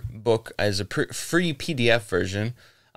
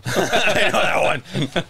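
laughing through the words; giggling reply; 9 semitones higher, 4 dB louder than usual. Real, not acted. Laughing while answering.

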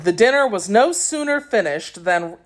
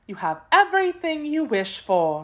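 gracious princess; 10 semitones higher than usual. A narrator's reading. Princess's dialogue in story.

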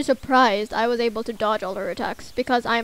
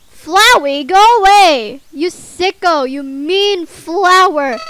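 loud, higher yell at the flower; angry yell; 5 semitones higher, 10 dB louder, slower than usual. Real, not acted. Player yelling at Flowey to go away, loud and angry. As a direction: tell him off loudly.

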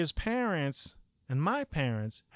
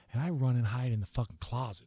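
ordinary delivery

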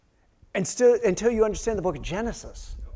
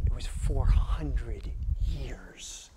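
slow, softer whisper on four hundred years; hushed whisper; 5 semitones lower, 7 dB quieter, slower than usual. Real, not acted. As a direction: breathe it out, grave and hushed. Solemn hush about silent God.

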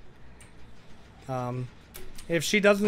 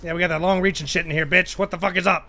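raised, louder trash talk; loud hype gloating; 4 semitones higher, 8 dB louder than usual. Real, not acted. Player shows off long reach in game, gloating loudly. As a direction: crow loudly at the opponent.

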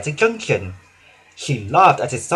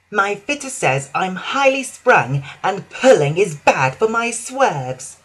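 high, slow prissy excuse; fussy diesel voice; 5 semitones higher, slower than usual. Acted. Daisy lying to her driver in a fussy voice. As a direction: put on a delicate airs. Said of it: "Daisy lied".

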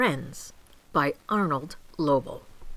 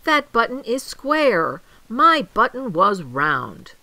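louder, mildly wailed protest; wailing; 5 semitones higher, 7 dB louder than usual. A narrator's reading. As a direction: complain loudly with a touch of a wail. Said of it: "wailed Toad".

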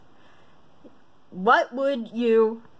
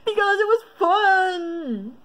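drawn-out, high-pitched exclamation; playful outburst; 8 semitones higher, slower than usual. Real, not acted. Quiz taker explains herself to viewers, playful outburst. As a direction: exclaim it higher, stretching it out.

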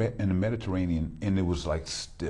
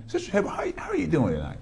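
bright, much higher host welcome; excited greeting; 7 semitones higher, faster than usual. Real, not acted. Host welcoming his guest on the show, excited and high. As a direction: sing out the guest's name in welcome.

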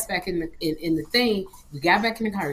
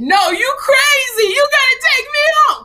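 crying; 10 dB louder than usual. Retold or imitated. Girl crying at the boyfriend to take her home. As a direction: sob and yell it through the car door. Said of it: "'cause I was crying".

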